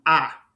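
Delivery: "Ah" said low as a short O. Shown as a demonstration. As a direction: open short "Ah". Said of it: "short O sound like".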